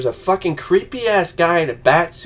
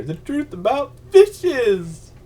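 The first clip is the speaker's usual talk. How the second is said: mocking title; 6 semitones higher, much slower than usual. Real, not acted. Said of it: "You get the analogy there".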